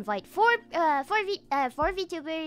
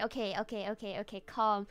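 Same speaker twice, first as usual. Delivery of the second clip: calming down; 7 semitones lower, 9 dB quieter, faster than usual. Real, not acted. Calming herself down.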